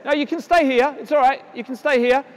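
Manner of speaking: ordinary delivery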